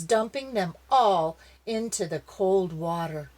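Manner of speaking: ordinary delivery, nothing marked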